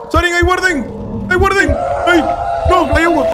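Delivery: high-pitched alarmed shouting; frightened high yell; panicked warning. Real, not acted. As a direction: call out danger frantically. Warning of monster in game.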